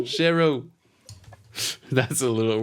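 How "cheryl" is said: put-on high Aussie accent slipping into cockney; Aussie gone cockney. Copying a friend's Cheryl in a slightly cockney Aussie accent. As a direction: go a little cockney, pitched up. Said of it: "went a little cockney there".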